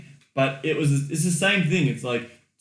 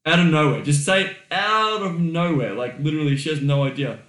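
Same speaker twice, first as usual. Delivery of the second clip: normal talking voice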